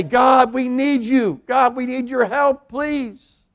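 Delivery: high, pleading cry to God; a plea for help, pitched high; desperate prayer. Retold or imitated. The prayer people don't say.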